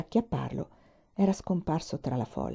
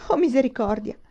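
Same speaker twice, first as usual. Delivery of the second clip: higher wailing lament; 6 semitones higher, 4 dB louder than usual. A narrator's reading. Jailer wailing as the prisoners leave, lamenting. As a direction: moan it to the heavens. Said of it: "si lagnò il carceriere".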